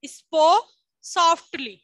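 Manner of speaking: stretched out slowly, word by word; slow dictation pace. Shown as a demonstration. Teacher to class, dictating slowly. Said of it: "meaning લખો".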